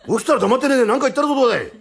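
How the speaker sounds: loud, high-pitched indignant outburst; startled scolding. Acted. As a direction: scold him after the fright. 驚いた泥棒が文句. (驚いて大声で文句)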